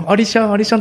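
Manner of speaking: high-pitched imitation of toddler babble; faltering toddler. Little boy singing to his mother on the walk, high faltering toddler talk. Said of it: "まだたどたどしい".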